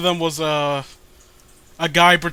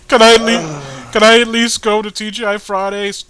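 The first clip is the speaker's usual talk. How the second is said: mock pleading; 3 semitones higher, 9 dB louder than usual. Pleading as Bionic in bit.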